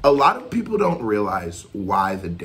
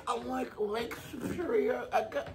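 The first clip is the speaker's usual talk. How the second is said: softly laughing the line back; 12 semitones higher, 11 dB quieter than usual. Real, not acted. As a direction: repeat it while barely holding in laughter. Reactor cracks up at the bar.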